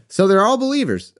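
a hint of suspicion; skeptical question.

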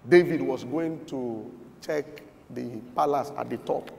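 normal talking voice

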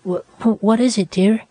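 put-on high, stammering frightened girl; chattering fear. A narrator's reading. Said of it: "she chattered".

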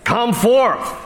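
high, ringing personal summons; Jesus summoning only Lazarus, high commanding call. Acted. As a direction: call one man by name from the grave. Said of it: "He said".

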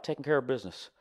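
lightly voiced fat-[name] patter; [name] impersonator. Fake [name] meeting everybody, light [name] voice. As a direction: work the room with a touch of the impersonator. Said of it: "doing the [name] thing".

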